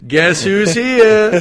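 very loud, high sing-out; hammed-up high, drawn-out announcement; comic raptor voice. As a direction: stretch it out slowly as a silly surprise entrance. Joins in voicing the raptor.

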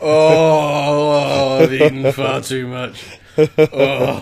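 loud groaning post-feast moan; bloated beast moaning about its full belly; overstuffed T-Rex. Retold or imitated. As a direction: groan like a dinosaur who ate far too much. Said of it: "then he does this massive burp. It's like".

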